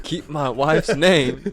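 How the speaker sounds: loud, high, drawn-out mock outburst; mock-angry shout. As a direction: bellow it slowly as a joke. Joking re-enactment of angry outburst.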